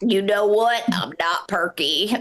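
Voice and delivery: slow, droopy cartoon hound voice; sluggish, downcast dog impression. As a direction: drag it out like a glum cartoon dog. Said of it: "Think of the cartoon Droopy Dog".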